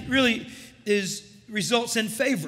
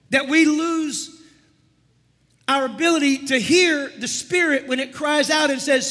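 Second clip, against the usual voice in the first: ordinary talk, nothing marked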